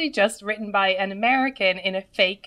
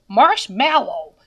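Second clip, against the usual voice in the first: "marshmallow" in a plain American accent, a little louder; 4 dB louder, slower than usual. Shown as a demonstration. Demonstrating how an American says it, plain American accent. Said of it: "Ask a person to say marshmallow".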